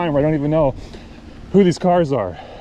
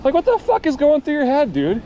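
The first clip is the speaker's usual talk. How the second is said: much higher, exasperated retelling of his own rant; heated retort; 12 semitones higher than usual. Retold or imitated. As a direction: re-enact the outburst at the driver, pitched way up. Retelling his heated roadside argument.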